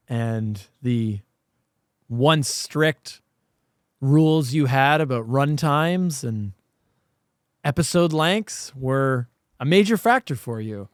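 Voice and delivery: ordinary talk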